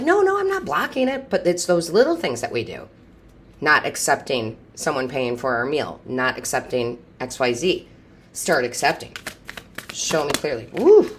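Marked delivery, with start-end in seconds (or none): 0.0-1.3 high, quick brush-off no-no; mock defensive denial. Viewers insisting they aren't blocking it, mock defensive and high. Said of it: "a lot of us are like". 10.7-11.1 high, loud whoop of delight; excited whoop. Real, not acted. Reader celebrating a good card, loud excited whoop.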